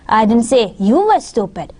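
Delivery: "you" stretched and lifted out of the sentence; stress on YOU. Shown as a demonstration. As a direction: put the weight on "you". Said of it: "let me say these three".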